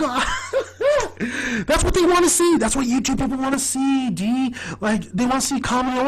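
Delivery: high, excitable insistence; Charlie. Retold or imitated. Charlie tells D what YouTube people want, high and insistent. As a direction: argue the point in a high, laughing voice. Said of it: "Charlie's like".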